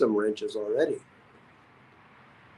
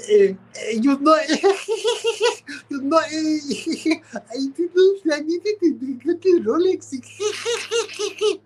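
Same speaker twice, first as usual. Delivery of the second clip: much higher giggling voice, words through laughter; laughing imitation; slower than usual. Streamer imitating a friend on request, high and giggling. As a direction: cackle out the lines in a squeaky voice. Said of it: "do some of the imitation".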